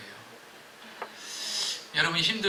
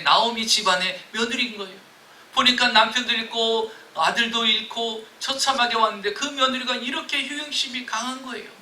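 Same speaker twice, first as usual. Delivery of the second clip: ordinary talk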